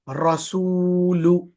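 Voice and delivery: रसूलु slowly, सू and लु with rounded lips, long ऊ held; rounded lips, stretched. Shown as a demonstration. Teacher demonstrating rounded lips on the pesh. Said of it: "सीन और लाम दोनों गोल होंठ के साथ".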